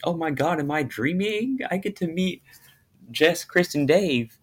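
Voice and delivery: high-pitched retold starstruck wonder; excited disbelief. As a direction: relive pinching yourself at the good news. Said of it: "I was so excited".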